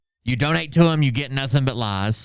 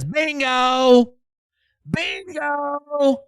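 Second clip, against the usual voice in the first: mock Phil shout; 13 semitones higher, much slower than usual. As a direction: squeal it out like a gloating fool. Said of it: "Phil says".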